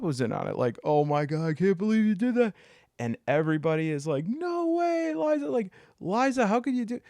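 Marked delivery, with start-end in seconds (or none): 0.8-2.5 mock shock. Rob in on the mock accusation. 4.3-7.0 high, hammed-up gasp of disbelief; mock group gasp. Everybody mock-gasping at Liza.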